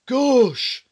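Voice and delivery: loud, high amazed exclamation; surprised gasp. A narrator's reading. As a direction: burst out in amazement. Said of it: "said Mister Tall".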